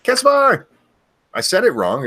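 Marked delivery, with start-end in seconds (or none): 0.0-0.7 loud high name shout; screaming names. Real, not acted. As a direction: belt the viewer's name out. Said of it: "hearing me say it wrong".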